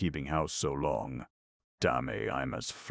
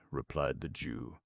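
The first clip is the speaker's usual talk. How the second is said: usual speaking voice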